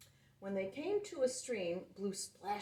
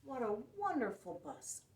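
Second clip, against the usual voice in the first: ordinary delivery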